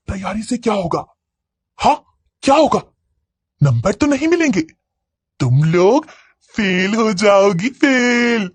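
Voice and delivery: deranged jeering that rises at the end; mad girl's taunting. A narrator's reading. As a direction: needle them, then crow the last word high. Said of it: "तभी एक पागल लड़की".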